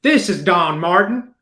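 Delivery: slow, lightly put-on Tennessee accent; mock Tennessee drawl. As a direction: copy the small-town Tennessee realtor, slowly. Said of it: "mirror that accent".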